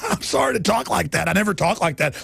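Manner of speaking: ordinary talk, nothing marked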